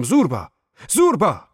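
loud call rising into a high scream. A narrator's reading. Narrator calling Zorba inside the tunnel, yelling. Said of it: "فریاد برآوردم".

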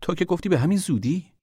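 high, indignant reproach; heated, high-pitched accusation; angry. A narrator's reading. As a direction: throw it back at him angrily. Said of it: "دختر با لحنی خشمگین گفت".

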